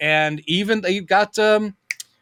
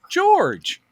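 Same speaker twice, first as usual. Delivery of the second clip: high, sing-song hello; cheerful greeting. Retold or imitated. Bryce Dallas Howard greeting George, high and bright. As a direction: greet an old family friend brightly. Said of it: "she's like".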